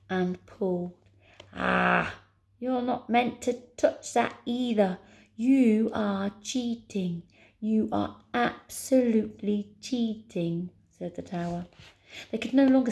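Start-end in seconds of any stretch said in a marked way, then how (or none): 1.5-10.7 drawn-out outraged accusation; indignant. Acted. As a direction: accuse them, stretching each word. Tower accuses them of cheating.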